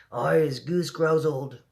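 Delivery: mock howl. A narrator's reading. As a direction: howl it, but half-hearted. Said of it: "howled the man mugger".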